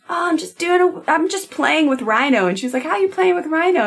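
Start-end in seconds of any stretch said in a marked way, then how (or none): 0.1-2.5 put-on high little toddler voice; tiny sweet child voice; teeny baby voice. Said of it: "little teeny baby voice". 2.8-3.8 high curious mom voice. Retold or imitated.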